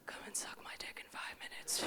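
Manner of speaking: hushed whisper; a sly whispered proposition. Acted. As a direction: breathe it secretly. Whispered aside in bit.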